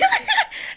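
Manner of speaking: quick high burst of laughter; short laugh. Real, not acted. Host laughing at Giselle's denial, short high laugh.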